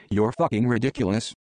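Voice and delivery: plain reading voice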